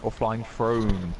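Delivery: very slow, stretched "Flying Throne"; drawn-out name. Real, not acted. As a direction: draw out each word of "Flying Throne". Offers the name again, stretched out.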